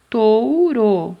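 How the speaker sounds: touro loud, with a long TO; emphasized first syllable. Shown as a demonstration. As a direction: punch and stretch touro. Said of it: "Começa com qual sílaba?".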